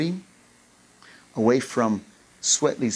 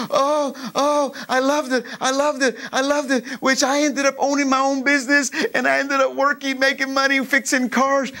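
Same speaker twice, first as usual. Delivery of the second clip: joyful remembering; 11 semitones higher than usual. Real, not acted. Preacher ecstatic recalling job.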